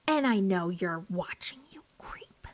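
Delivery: whispered teasing aside. Real, not acted. Vlogger teasing a cast member who watches her videos, whispered. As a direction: whisper it like you're telling a secret.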